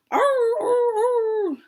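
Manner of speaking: high, loud doggy grunts; dog struggling and grumbling; muffled dog noises. Acted. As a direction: growl like a dog stuck under a sheet. Pluto stumbles under the sheet.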